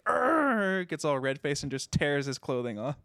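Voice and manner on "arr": high-pitched mad "arr" outburst; mock-enraged growl, pitched up; angry cartoon growl. Podcaster imitating a man tearing his robes, mad growl. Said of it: "he just gets mad".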